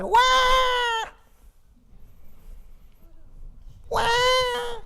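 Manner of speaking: very high crying wail; wailing baby cry. Acted. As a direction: wail like a hungry child. Hungry child cries.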